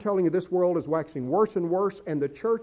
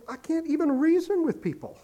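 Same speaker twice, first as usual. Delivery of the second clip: pitched way up, voicing a fed-up churchgoer; mock exasperation, raised high; exasperated listener; 12 semitones higher than usual. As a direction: sound like you've given up on arguing. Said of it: "And you think".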